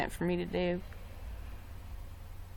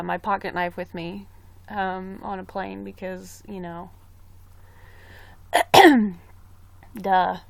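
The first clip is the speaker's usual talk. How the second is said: ordinary talk, nothing marked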